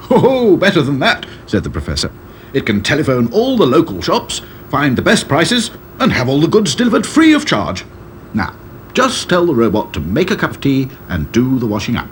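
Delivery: eccentric professor. A narrator's reading. Professor boasts of robot.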